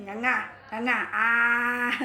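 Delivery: giggly chomping sounds, ending in a loud cry; playful laughing. Real, not acted. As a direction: laugh while playing with bites.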